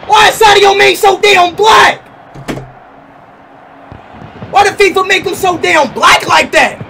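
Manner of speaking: loud, high, drawn-out hype; excited shout. Real, not acted. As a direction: yell it like a fan. Hyped rant on card.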